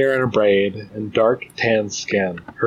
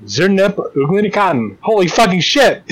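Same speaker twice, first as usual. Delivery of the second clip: sudden startled outburst, higher; shocked exclaim; 8 semitones higher, 3 dB louder than usual. Acted. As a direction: blurt it out in shock. Visitor shocked at opening door.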